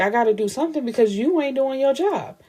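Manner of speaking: high-pitched, indignant client voice; indignant retort. Retold or imitated. Client telling his lawyer she isn't working, indignant.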